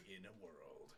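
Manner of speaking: deep, quiet trailer narrator; movie trailer voice. Retold or imitated. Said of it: "movie producer voice".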